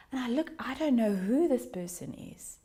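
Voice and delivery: ordinary delivery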